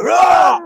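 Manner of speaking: huge high-pitched drawn-out roar; roaring yell. Acted. Reviewer miming a big American charging a sheep, roaring yell. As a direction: let out a long, very loud high yell.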